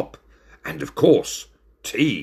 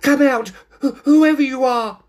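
high, loud, stammering call; very afraid; 6 dB louder than usual. A narrator's reading. Trembling cat challenges a hidden stranger, afraid. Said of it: "He was very afraid".

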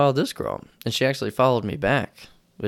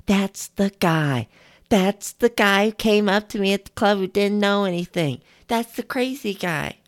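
put-on girl pointing him out; 10 semitones higher than usual. Girl at the gym pointing him out, high mock gossip. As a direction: point him out to your friends. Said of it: "she points at me".

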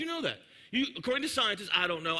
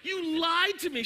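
put-on whiny accusing kid; 8 semitones higher, slower than usual. Child accusing his mother.

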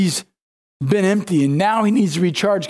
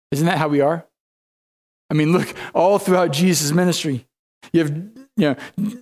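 usual speaking voice

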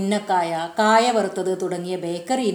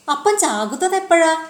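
eager question; 8 semitones higher than usual. A narrator's reading. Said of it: "ജോസിനറിയേണ്ടത് അതാണ്".

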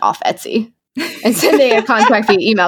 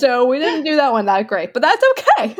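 giggling, pitch climbing high; laughing; 5 semitones higher than usual. Real, not acted. Laughing at their weak answer.

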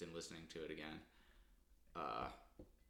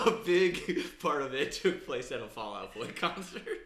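loud, high, cracking up mid-sentence; can barely get it out for laughing; 7 semitones higher, 11 dB louder than usual. Real, not acted. Co-host adding an absurd concert detail to the feud, laughing hard.

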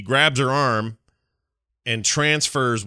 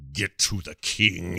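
jittery shaky; 4 semitones lower, 4 dB quieter, slower than usual. Retold or imitated. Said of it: "in this very jittery, sort of shaky way".

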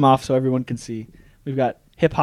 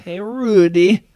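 slow, raised comic TV-dad impression; Bill Cosby; 7 semitones higher, 6 dB louder, much slower than usual. Shown as a demonstration. Friend answers request for a Cosby impression, bad Cosby voice. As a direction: drag out the call, higher and louder. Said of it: "can you do a Cosby impression".

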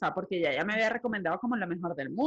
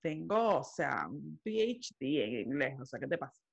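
mock cocky; 8 semitones lower, 5 dB quieter than usual. Answering the job interviewer about speaking English, mock cocky. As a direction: play it offhand and over-confident, pitched low.

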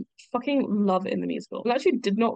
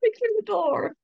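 high mock voice; 13 semitones higher than usual. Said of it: "mean to shoot him".

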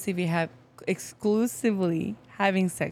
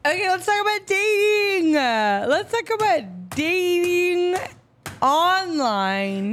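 tipsy announcer voice; 9 semitones higher, slower than usual. Real, not acted. Drunk host restarting topic.

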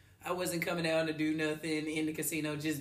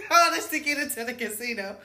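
loud, higher, through laughter; laughing exclamation; 5 semitones higher, 9 dB louder than usual. Real, not acted. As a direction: exclaim it while cracking up. Laughing at the absurd ordeal.

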